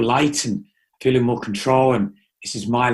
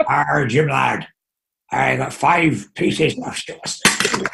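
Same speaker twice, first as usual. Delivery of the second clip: Johnny Depp pirate; much slower than usual. Pretend. Host plays pirate with a coin in his eye, slow mock pirate. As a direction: drag out a pirate captain's boast. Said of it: "my Johnny Depp impression".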